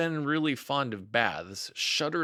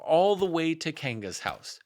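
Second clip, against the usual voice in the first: ordinary delivery, nothing marked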